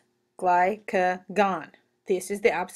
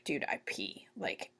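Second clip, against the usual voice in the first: patient voiced; 2 semitones lower, 15 dB quieter than usual. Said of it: "they're like".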